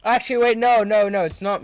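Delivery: sudden realisation. Real, not acted. Suddenly changes his pick.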